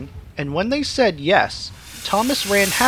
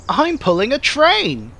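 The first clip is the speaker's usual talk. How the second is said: higher, proud boast; proud little engine; 6 semitones higher than usual. A narrator's reading. Thomas boasting to the signalman, higher proud voice. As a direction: puff up with pride. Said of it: "said Thomas proudly".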